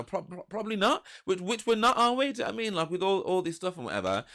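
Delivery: high-pitched, acted backpedalling; retold defensive reply. Man asked if he's in love, high defensive retelling.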